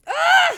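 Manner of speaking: sudden loud, high cry; short high shout breaking into a laugh; pained outburst. Real, not acted. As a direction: yell "ah" in exasperated anguish. Pained outburst over sad scene.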